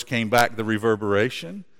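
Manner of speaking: usual speaking voice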